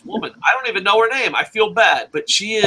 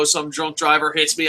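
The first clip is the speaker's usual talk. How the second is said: ordinary delivery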